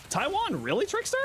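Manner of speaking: delighted recognition of Taiwan; a thrilled Taiwan, pitched well up and falling; excited. Real, not acted. Excited reaction to a chat location.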